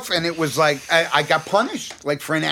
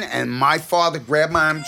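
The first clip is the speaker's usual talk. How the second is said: ordinary delivery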